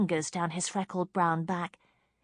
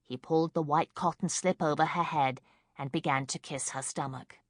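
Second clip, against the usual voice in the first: plain reading voice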